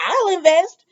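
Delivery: a lightly voiced eager woman volunteering; eager Carly. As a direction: pipe up high and eager. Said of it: "and she's like".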